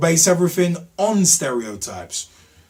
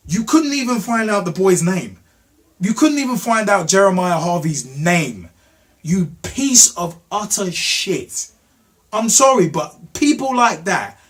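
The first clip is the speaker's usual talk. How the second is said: raised, indignant voice; angry rebuke; 6 semitones higher, 5 dB louder than usual. Real, not acted. Telling off Miss Klein over the boy's name, angry. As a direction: hammer each phrase in real anger.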